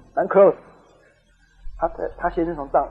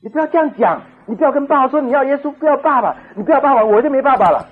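high, acted child's plea, getting louder; tearful pleading; 9 semitones higher, 6 dB louder than usual. Daughter begging mother over the phone, imitated tearful pleading. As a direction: beg like a crying daughter, hammed-up. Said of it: "哭着说".

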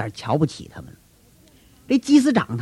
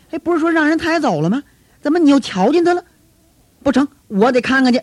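excited disbelief; 9 semitones higher, faster than usual. Acted. Peter disbelieving, rushing.

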